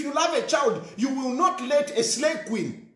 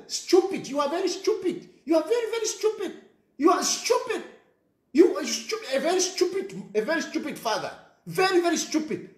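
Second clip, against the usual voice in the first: mocking insult; 6 semitones higher than usual. Real, not acted. Ranting insult at a father.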